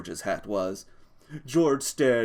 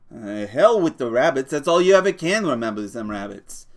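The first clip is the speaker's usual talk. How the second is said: annoyed; 3 semitones higher, 7 dB louder than usual. Acted. George annoyed at rabbits.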